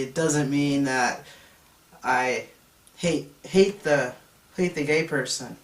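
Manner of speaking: loud, firm objection, pressing each point. Retold or imitated. Student answering the teacher in class, loud insistent protest. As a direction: push back hard against the teacher in front of class.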